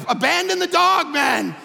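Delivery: loud, laughing yell to give up; mock shout. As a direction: holler it half joking. Dad shouting to family.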